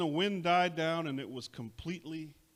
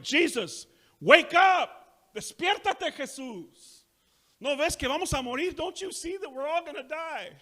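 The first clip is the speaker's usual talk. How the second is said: high, loud panicked shouting; panicked yell; 10 semitones higher, 10 dB louder than usual. Acted. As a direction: shout in panic at the sleeping Jesus. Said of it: "freaked out".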